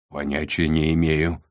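ordinary delivery

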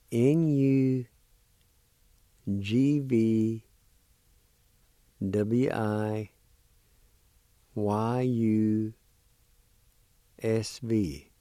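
slow, careful letter-by-letter spelling; spelled out letter by letter. Shown as a demonstration. Teacher spelling the word for students, letter by letter. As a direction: say each letter pair clearly, pausing. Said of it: "First part is spelled like this".

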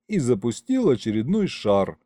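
ordinary delivery, nothing marked

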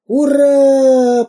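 очень высокий громкий клич; подхвати хором, ликуя; ликующие мальчишки. Acted. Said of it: "подхватили все окрестные мальчишки". (мальчишки подхватывают «ура!» с ликованием)

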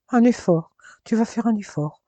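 plus aigu, faussement outré; étonnement moqueur. Acted. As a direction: reprendre ses mots avec une surprise taquine. Elle s'étonne, taquine, de sa réponse. (étonnement espiègle et plus aigu)

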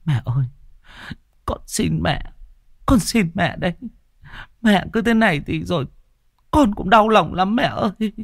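a daughter clinging to her mother, tearful; sobbing daughter. A narrator's reading. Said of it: "Cô Vun cứ ghì lấy mẹ mà nức nở".

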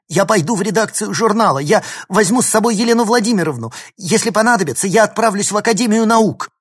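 high, loud, rapid outpouring; agitated threat. A narrator's reading. Tearful girl threatens to report professor, loud and agitated. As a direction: rush the threats out, worked up.

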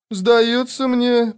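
high, slow drawl; slow, drawn-out high delivery. A narrator's reading. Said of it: "протянула она".

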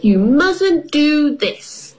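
put-on slow, high, bossy little girl. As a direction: tell him off like a bossy big sister. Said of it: "Peppa says in a bossy voice".

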